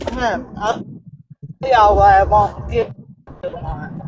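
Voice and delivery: talking around a mouthful of ice; words muffled by chewing ice. Real, not acted. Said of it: "you got ice in your mouth".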